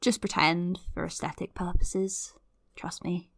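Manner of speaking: conspiratorial whisper, barely voiced; a hushed aside to the audience. Real, not acted. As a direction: let the listeners in on a secret. Playful aside to listeners.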